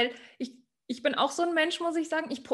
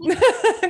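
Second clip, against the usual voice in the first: high, loud burst of laughter; 8 semitones higher, 9 dB louder than usual. Real, not acted. Host laughing after cutting into the guest's story, loud and high.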